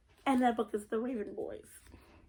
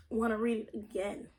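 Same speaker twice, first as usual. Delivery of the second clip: ordinary talk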